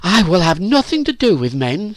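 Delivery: put-on high, emphatic girl's refusal; scornful refusal. A narrator's reading. Phyllis rejects any interest in men, scornful.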